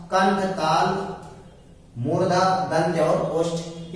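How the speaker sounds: मूर्धा, दंत और ओष्ठ said slowly, word by word; slow recitation. Shown as a demonstration. Teacher slowly repeating the list so students memorise it. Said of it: "इनको क्रम से याद रखना".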